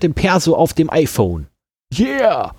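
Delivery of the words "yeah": übertrieben hohes, spöttisches Yeah; sarkastischer Jubel. Real, not acted. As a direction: ironisch jubeln. Spöttischer Jubel über Ausweis-App.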